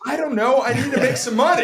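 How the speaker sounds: high, loud comic yell; mock panicked outburst. Embarrassed bar host answering the girl who recognized him, comic panicked yell. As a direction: blurt it at the top of your voice. Acts his flustered answer to her.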